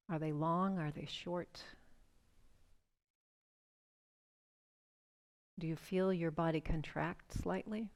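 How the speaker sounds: soothing breathwork guide; guided breathing meditation. Acted. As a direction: ease them into the breath, unhurried. Leads a guided breathing exercise.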